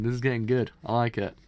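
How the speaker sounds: ordinary delivery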